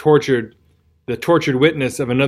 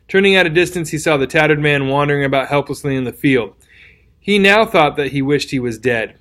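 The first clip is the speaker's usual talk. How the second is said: plain reading voice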